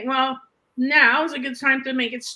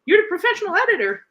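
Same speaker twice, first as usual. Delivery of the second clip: faster, with a laugh that breaks out at the end; 5 semitones higher, 4 dB louder, faster than usual. Real, not acted. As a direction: chuckle through the end of the line. Laughs finishing her retold reply.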